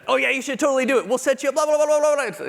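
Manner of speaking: high, loud and fast gushing; mock-enthusiastic colleague rushing through the blahs; excited. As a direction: rattle it off like an eager cheerleader. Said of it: "he got really excited".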